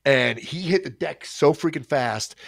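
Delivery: usual speaking voice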